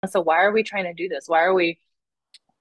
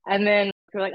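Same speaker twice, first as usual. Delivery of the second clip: ordinary delivery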